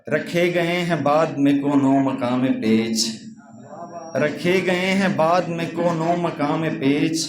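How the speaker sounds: measured mushaira recitation with lingering phrases; poem recital. Acted. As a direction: recite each couplet gravely, repeating the first line. Recites couplets.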